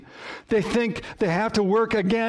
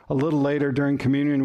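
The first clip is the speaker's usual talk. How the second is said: ordinary talk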